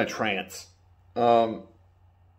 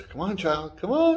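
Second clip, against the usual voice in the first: high, playful coaxing of a little one; 8 semitones higher than usual. Retold or imitated. Mando urges the child to come on, higher coaxing. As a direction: lilt upward as if urging a child.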